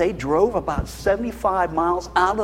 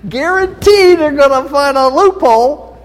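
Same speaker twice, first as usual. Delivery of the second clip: louder, laughing through the words; laughing, louder punchline; 6 semitones higher, 7 dB louder than usual. Real, not acted. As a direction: tell it still laughing. Laughing warning about kids.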